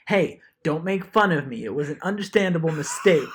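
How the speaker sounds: mock protest at being made fun of; indignant substitute. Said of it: "the substitute was like".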